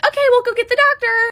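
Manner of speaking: high, bright-toned imitation of the nurse; chirpy nurse. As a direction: play the breezy nurse. Nurse voiced in retold story.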